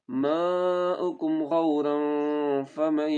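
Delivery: melodic chanted tajweed recitation; Quran recitation. Shown as a demonstration. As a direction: recite slowly and musically, stretching vowels.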